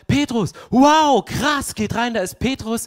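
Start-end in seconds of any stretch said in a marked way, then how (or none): 0.1-1.7 high, loud, slow gasp of amazement; amazed servant girl. Retold or imitated. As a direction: burst out in disbelief at the door. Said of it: "die Dienerin rausgeht und sagt".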